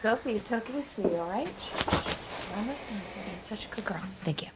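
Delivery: hushed, soft reassurance to a pet; calm the frightened dog softly; quiet coaxing voice for a scared dog; soothing the dog. Real, not acted. Hushing her dog during recording.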